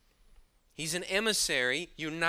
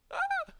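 mock startled yelp. Startled cry at mummy image.

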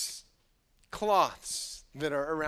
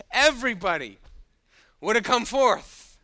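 put-on child's answer; 6 semitones higher, 9 dB louder than usual. Sunday school student answering teacher, hammed-up punchline. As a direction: deliver the student's punchline big. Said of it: "one of the students says".

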